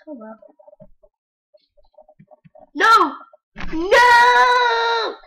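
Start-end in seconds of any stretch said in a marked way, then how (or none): 2.7-5.2 high, loud "No!" twice; real shout. Real, not acted. Gamer yelling at his granddad in the game, high and loud. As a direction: scream it in dismay.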